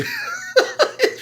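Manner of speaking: very high, short giggle; a quick squeal of laughter; squeaky laugh. Real, not acted. Vlogger mocks Lou taking no risk, squeaky giggle.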